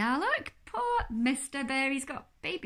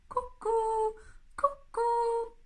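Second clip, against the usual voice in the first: high, loud two-note hoot; cuckoo clock call; 10 semitones higher, 4 dB louder, much slower than usual. Acted. Living room clock wakes the bear, high cuckoo call.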